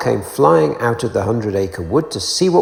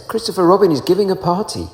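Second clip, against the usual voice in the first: higher, quick fussy owl voice; 8 semitones higher, faster than usual. Acted. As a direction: rush out the news like a busy bird. Owl announces to Pooh.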